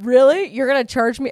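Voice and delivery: sarcastic angry retort at the dealer; ticked off. Retold or imitated. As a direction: throw it back at him in disbelief. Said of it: "I was so ticked at that point".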